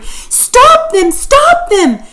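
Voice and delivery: high, loud yell of alarm; frantic yell to stop the fight. A narrator's reading. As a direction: shout it to stop the dogs. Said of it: "Sam yelled".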